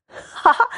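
loud, high ha-ha burst; big mocking guffaw; hearty laugh. A narrator's reading. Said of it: "笑道". (高声哈哈大笑)

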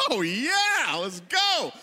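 drawn-out hyped-up cheer; mock excitement. Preacher mimicking his eager audience, mock hyped cheer. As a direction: cheer like an over-eager crowd.

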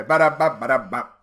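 imitated rimshot. Rimshot after joke.

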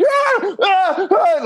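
three high imitated screams; repeated imitated screams. Dad screaming on his knees in the elevator, repeated high screams. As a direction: shriek over and over in panic. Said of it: "he was like".

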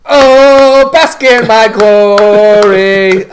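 high, loud and slow, savouring each word; hammed-up glory call; mock grand proclamation. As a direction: proclaim it like a preening wrestler. Mock grand wrestler entrance.

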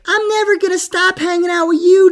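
put-on very high little-boy voice; little son. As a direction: imitate a young son pledging to his dad. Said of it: "He'd be like".

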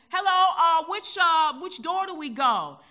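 loud, high call to the carriage; New Yorker shouting directions on the train; American hat. Retold or imitated. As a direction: call out brashly to take charge. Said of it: "I put on my American hat".

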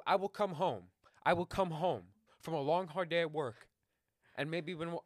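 loud, high, worked-up complaint; excited rant. Real, not acted. Excited rant about puppy rules.

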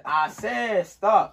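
slow, loud barked order; barking gym coach. Pretend. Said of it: "You gotta have a deep voice".

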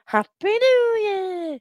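high, drawn-out celebratory call; New Year cheer. Real, not acted. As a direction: sing out the greeting slowly.

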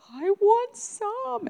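put-on high, drawn-out little girl's plea; wanting little child. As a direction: beg like a three-year-old, stretched and high. Retold child wanting rollerblades.

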